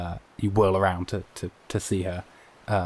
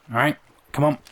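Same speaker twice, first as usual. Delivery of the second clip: higher brisk voice; 7 semitones higher, 4 dB louder, faster than usual. Acted. Morgan voiced by GM.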